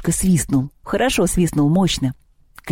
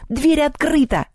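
high-pitched exasperated goblin cry; defeated goblin outburst; goblin giving in; 11 semitones higher, slower than usual. A narrator's reading. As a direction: give up in a high, put-out voice. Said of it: "сдался домовой".

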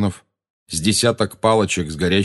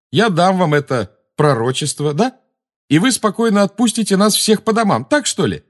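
high, loud, incredulous scoffing; sarcastic agreement; 11 semitones higher, 5 dB louder than usual. A narrator's reading. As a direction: climb ever higher, mocking the offer. Harry sarcastically pretends to agree. (с издёвкой, громко и высоко)

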